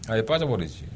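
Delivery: ordinary talk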